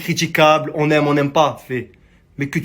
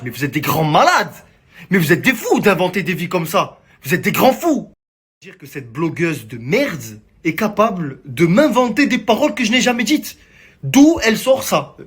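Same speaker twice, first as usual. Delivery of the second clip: real indignation; 3 semitones higher than usual. Real, not acted. Man denouncing bloggers who invent stories about him, indignant outburst. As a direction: protest in disbelief, hitting the insults hard. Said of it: "pourquoi je suis énervé".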